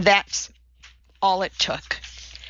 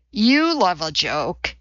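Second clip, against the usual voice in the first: usual speaking voice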